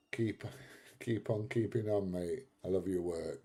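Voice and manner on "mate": usual speaking voice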